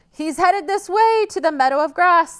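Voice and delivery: high, loud eager goat; excited goat. Acted. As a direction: urge the troll on. Goat voice continues.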